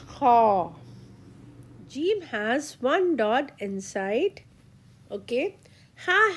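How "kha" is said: Kha as a scraping K H in the throat; raspy Kha. Shown as a demonstration. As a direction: rasp the Kha from the back of the throat. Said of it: "sounds like K H in English".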